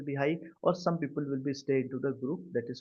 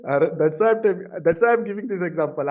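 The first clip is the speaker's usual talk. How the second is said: loud laughing explanation; 4 semitones higher, 8 dB louder than usual. Real, not acted. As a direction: laugh while you explain. Laughs at student's BTM remark.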